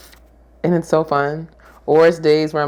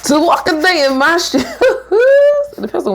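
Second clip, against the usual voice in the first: laughing; 14 semitones higher, 5 dB louder than usual. Real, not acted.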